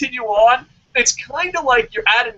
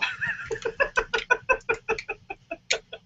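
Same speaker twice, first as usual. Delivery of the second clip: high, soft run of laughter; long giggle; 14 semitones higher, 8 dB quieter than usual. Real, not acted. Podcaster cracking up after his fake slip, giggling. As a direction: giggle helplessly at your own joke.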